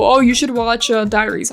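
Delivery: ordinary talk, nothing marked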